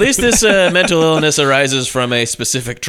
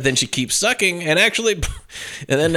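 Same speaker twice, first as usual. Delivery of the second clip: normal talking voice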